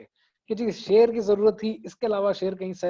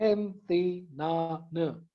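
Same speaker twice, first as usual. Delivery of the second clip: Pakistan said very slowly, split into syllables; counting syllables; 4 dB quieter, much slower than usual. Shown as a demonstration. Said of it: "चार syllables हैं". (पाकिस्तान को धीरे धीरे syllable में तोड़कर बोलना)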